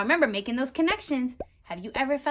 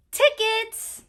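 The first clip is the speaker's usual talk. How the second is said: higher, louder, chirpy; chirped; 10 semitones higher, 7 dB louder than usual. Acted. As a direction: chirp it brightly at the door. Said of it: "chirped the lady".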